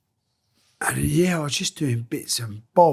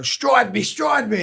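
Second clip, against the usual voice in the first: high-pitched playful call; mock urgent call, pitched up; 9 semitones higher, slower than usual. Worker halfway up a ladder shouts to his mate, mock calling out.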